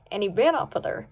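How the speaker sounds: usual speaking voice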